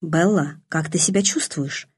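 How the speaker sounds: ordinary delivery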